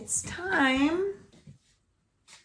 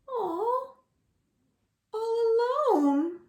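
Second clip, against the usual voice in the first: pitying aw; 10 semitones higher than usual. A narrator's reading. Narrator pities lonely pig.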